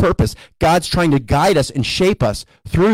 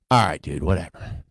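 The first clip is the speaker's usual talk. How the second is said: grudging sigh; 14 semitones lower, 6 dB quieter than usual. Retold or imitated. Tired disciples grudgingly agree.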